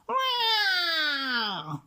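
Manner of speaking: high, drawn-out falling cat wail; cat yowl. A narrator's reading. Scarface Claw in the tree, a long high yowl. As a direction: wail it like a cornered tomcat. The cat's yowl echoes around.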